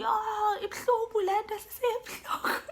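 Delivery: high strained wail, fading to breath; mock pained wail. To the nurse in labor, high pained whimper. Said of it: "I was screaming".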